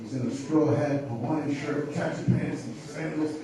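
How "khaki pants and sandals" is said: ordinary talk, nothing marked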